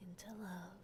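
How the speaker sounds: breathy whisper of the last words. Acted. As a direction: drop to a whisper, leaning in close. Whispered countdown-style pill scene.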